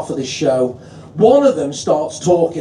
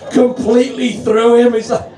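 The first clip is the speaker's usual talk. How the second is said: ordinary talk, nothing marked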